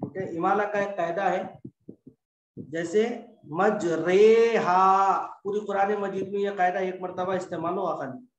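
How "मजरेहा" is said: मजरेहा with a long, loud 'ray' sound; imala pronunciation. Shown as a demonstration. Teacher giving an example of the imala rule, मजरेहा with long 'ray'. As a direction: draw out the रे in मजरेहा, loudly. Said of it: "इमाला की हालत में भी".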